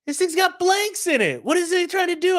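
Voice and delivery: high-pitched mock hitman. Voicing the hitman in the apartment scene, high and incredulous. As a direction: squeak it out as the baffled hitman.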